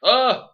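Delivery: high, loud "oh!"; exaggerated retold outburst; mock cry. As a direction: yelp it as the wrestler. Acting out the wrestler's reaction.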